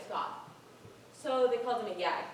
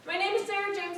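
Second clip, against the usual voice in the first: louder, pushing through; insistent; 6 semitones higher, 4 dB louder than usual. Acted. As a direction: repeat it firmly over protest. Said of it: "Will you please stop it?".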